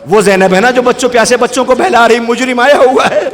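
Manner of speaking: preacher crying out at the climax; rising sermon cry. Real, not acted. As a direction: let the voice soar in grief. Preacher's climax about Zainab.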